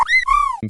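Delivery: very high, loud shriek; short mock scream of terror at a piercing pitch; imitated scream.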